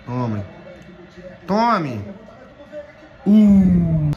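usual speaking voice

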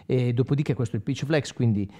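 ordinary talk, nothing marked

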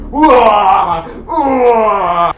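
drawn-out wailing effort cry; strongman roar. Pretend. [name] showing his biceps in action, long straining roars. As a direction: roar while flexing for the crowd.

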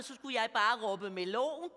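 ordinary talk

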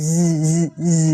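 "z" as repeated long voiced buzzes; buzzed Z. Shown as a demonstration. Teacher shows a sound new to learners, buzzed. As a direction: buzz each "z" on its own. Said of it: "มันจะเป็นแบบ".

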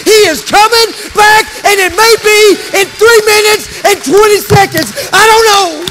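building to a high pulpit shout; fervent, ringing declaration; full-force preaching. Real, not acted. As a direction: proclaim it loudly to the rafters.

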